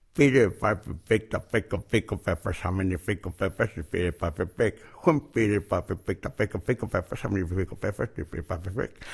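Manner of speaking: tongue twister mumbled around a pen held in the teeth; pen in mouth. Shown as a demonstration. Showing the audience how a pen in the teeth slurs the tongue twister. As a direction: show the class a tongue hampered by a pen. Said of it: "Te pones la pluma en la boca y empiezas".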